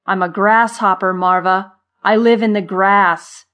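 exasperated rant. A narrator's reading. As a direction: rant at your friend, hammering each point. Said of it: "bulging with exasperation".

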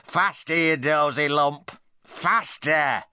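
high, squawking parrot insults. Acted.

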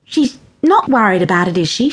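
ordinary delivery

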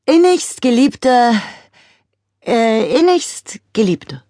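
slow, solemn mock-funeral voice. A narrator's reading. Girl speaking at a plant's funeral, solemn. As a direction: intone like a pompous preacher. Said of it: "mit ihrer besten Beerdigungsstimme".

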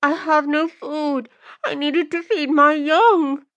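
high, slow, pleading woman's voice; imploring. Acted. Accused mother explaining her theft to the lords, imploring. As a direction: beg slowly in a high, helpless voice. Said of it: "implores the woman".

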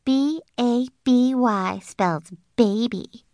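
slow, sing-song mocking spelling; taunting spell-out. May taunting Junie B across the aisle, slow and mocking. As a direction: spell it out slowly to tease her. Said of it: "She leaned across the aisle at me".

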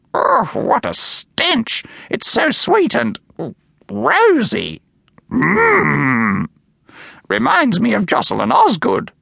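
high, slow, squawky disgust; disgusted parrot. A narrator's reading. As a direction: savour the stink like a fussy bird. Doris reacts to perfume.

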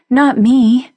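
fierce outburst waking from a nightmare. Acted. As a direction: throw it back hard at her. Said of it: "Kate said fiercely".

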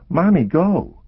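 put-on high, loud child's plea; urgent little girl. A narrator's reading. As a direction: push mommy like a scared child. Child character voice.

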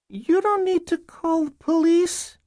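put-on very high little-girl voice; young girl. Girl pleading with caseworkers at the door, young child voice. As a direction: pitch it way up like a small child.

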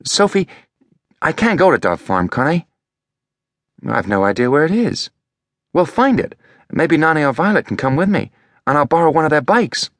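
put-on a boy explaining himself, a bit defensive; lightly voiced boy Elliot. A narrator's reading.

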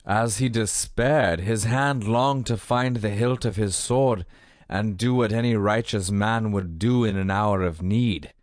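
usual speaking voice

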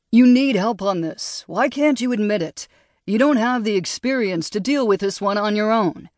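a hint of a smug coworker; condescending colleague. A narrator's reading. Drew presses Zoe.

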